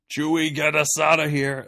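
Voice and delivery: mock urgent shout; imitated yell. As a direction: yell it out like a movie hero. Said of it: "That's what he yells at the dogs".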